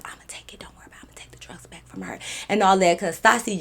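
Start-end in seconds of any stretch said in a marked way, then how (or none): 0.0-2.2 secret unvoiced whisper. Whispering to the girl behind her drunk friend's back. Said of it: "I'm whispering to the girl".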